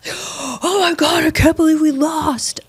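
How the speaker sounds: high, drawn-out mock wail; gasp, then fake-cry it slowly; hammed-up heartbreak over a lost game; overdramatic sore loser. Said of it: "so dramatic".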